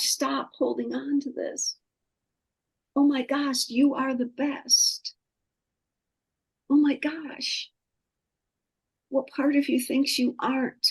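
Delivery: high, soft, tearful pleading; crying, in halting phrases with long pauses; crying plea. Real, not acted. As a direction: plead with yourself through real tears. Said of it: "I'm crying right now".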